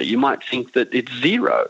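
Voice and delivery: ordinary talk, nothing marked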